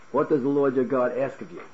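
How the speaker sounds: normal talking voice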